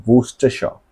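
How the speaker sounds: वूस्टरशायर as woos-ter-sher; Worcestershire said right. Shown as a demonstration. Teacher corrects students' वूस्टरशायर to woos-ter-sher. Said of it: "इसको pronunciation कैसे बोलेंगे".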